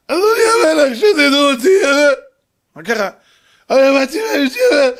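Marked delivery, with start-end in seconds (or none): 0.1-1.7 loud, high sobbing plea; hammed-up wailing. Robbed man wails to king. 3.7-4.9 loud, high tearful blubbering; hammed-up wailing. Robbed man keeps wailing.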